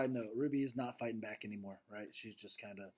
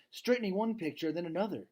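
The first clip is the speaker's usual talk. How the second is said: plain reading voice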